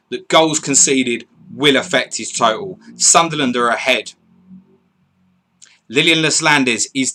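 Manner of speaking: loud, punchy football commentary; big opening call, settling into steady reporting; live goal announcement. Acted. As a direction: announce the goal in measured, emphatic phrases.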